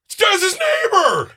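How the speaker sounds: high-pitched, drawn-out imitated holler; yelling across the room. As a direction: call out slowly to someone far off. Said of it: "he yelled out my name in front of the entire room".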